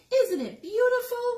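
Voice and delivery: high, loud, proud little voice; proud Hedgie. Acted. Hedgie proudly asks the hen, high proud voice. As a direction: show off the new hat.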